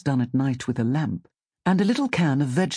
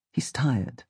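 usual speaking voice

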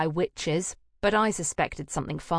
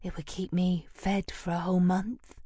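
low sultry voice. Acted. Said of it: "in a very low, sultry voice".

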